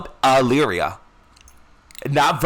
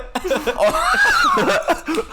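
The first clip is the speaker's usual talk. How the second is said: high laughing shriek. Real, not acted. Podcast host shrieking with laughter at herself. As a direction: scream with laughter.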